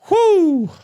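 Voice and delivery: high-pitched whooping exhale; high whew. Real, not acted. Relief sound after a rant.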